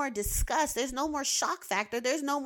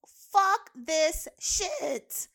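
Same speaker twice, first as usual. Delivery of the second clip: drawn-out defiance; 6 semitones higher, much slower than usual. Pretend. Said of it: "we can be like".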